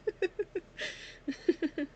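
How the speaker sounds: a burst of high laughter through tears; high teary giggle. Real, not acted. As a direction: giggle up high, still tearful. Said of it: "have a little giggle on it".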